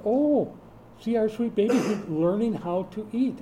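high, sing-song baby talk imitating his wife; cooing wife. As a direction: coo it like a proud mom to her baby.

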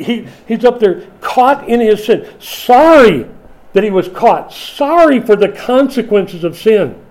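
high, loud hammed-up preacher remorse; mock televangelist. Preacher mocking a caught televangelist, high and loud. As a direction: ham up a weeping TV preacher.

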